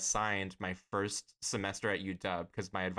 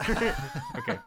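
very high laughing okay; amused surprise; 4 dB louder than usual. Real, not acted. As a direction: squeal it with teasing delight. Reacts to friend's crush.

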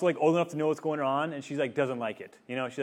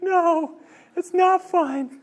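put-on much higher, slow, whiny little girl; pouty toddler voice; 7 dB louder, much slower than usual. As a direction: stretch it out like a lonely little kid. Daughter insists car is broken.

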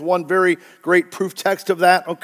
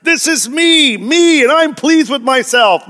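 high thrown voice, silly and raised; mock ventriloquist voice; 7 semitones higher, 4 dB louder than usual. Preacher mocks Jesus as ventriloquist, high thrown voice. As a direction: pitch it up like a ventriloquist's act. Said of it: "throw his voice in the air like a ventriloquist".

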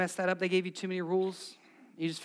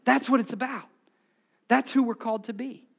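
loud, high-pitched preacher's crescendo; impassioned sermon climax, pitched high and loud; full-force preaching; 8 semitones higher, 12 dB louder than usual. Real, not acted. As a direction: drive each phrase home at the top of your voice.

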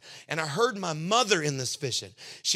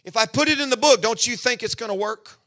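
higher, louder rhetorical challenge; God rousing his worried son; preaching God's reassurance; 5 semitones higher, 6 dB louder than usual. Retold or imitated. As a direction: press the point with fire. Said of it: "He said".

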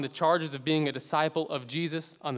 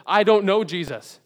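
much louder, higher, flat-out denial; emphatic denial; 6 semitones higher, 12 dB louder than usual. Acted. Peter denying Jesus to the bystanders, loud and emphatic. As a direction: throw the words out hard.

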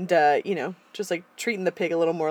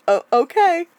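mildly impressed; 10 semitones higher, 9 dB louder than usual. Retold or imitated. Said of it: "And he's like".

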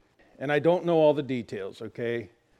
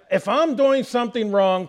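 louder, pressing firmly on "If I'm doing something wrong"; firm insistence; 7 semitones higher, 6 dB louder than usual. Retold or imitated. Pastor pressing back firmly at the critical visitor, louder. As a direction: push back hard on the visitor. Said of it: "I thought my responsibility was".

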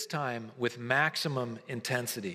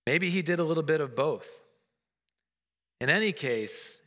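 normal talking voice

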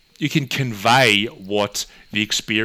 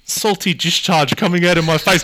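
mock Jerry bewilderment; 9 semitones higher, 4 dB louder than usual. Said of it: "and he's like".

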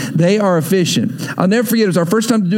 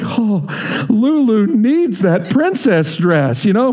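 higher, drawn-out splurging excitement; mock gushing shopper; 4 semitones higher, slower than usual. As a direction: gush as if talking yourself into buying. Acting out eager cruise shoppers.

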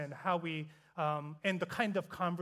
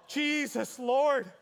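stunned exclamation; 5 semitones higher, 5 dB louder, much slower than usual. Real, not acted. Reacting to friends' bold rebuke.

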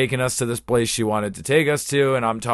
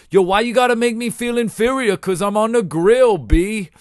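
higher, fast, swaggering slang voice; movie character impression; 9 semitones higher, 4 dB louder than usual. Imitating the Half Baked dude, higher and swaggering. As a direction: strut it like the dude from the film. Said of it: "the sort of Wigga dude in, uh, Half Baked".

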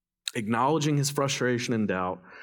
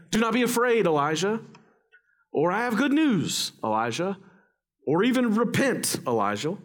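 lightly voiced angel proclamation, pitched high; mock angelic announcement; 8 semitones higher than usual. Mimics typical angel announcements not given.

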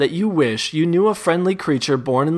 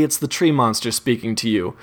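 ordinary delivery

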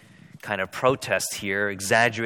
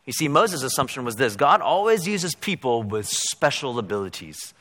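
ordinary talk, nothing marked

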